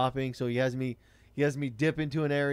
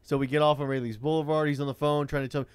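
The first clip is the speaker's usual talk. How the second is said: ordinary talk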